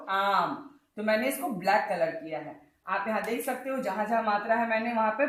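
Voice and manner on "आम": आम with a long, wide-open आ; open-mouthed aa. Shown as a demonstration. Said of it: "मुंह खोल के बोलो".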